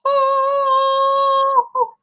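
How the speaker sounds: high, loud astonished oh; stunned gasp. Retold or imitated. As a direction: gasp it out in total disbelief. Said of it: "And I'm like".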